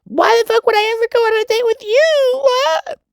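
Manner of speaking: hammed-up falsetto scorn; mock high-pitched girl voice. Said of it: "it's also gonna be like".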